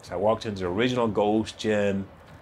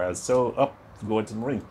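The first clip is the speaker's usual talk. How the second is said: usual speaking voice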